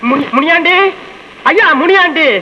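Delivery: high-pitched call to someone indoors; hollering at the door for someone to come out. Acted. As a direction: call the name out, waiting between calls. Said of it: "ஏன் இப்படி கத்துற?".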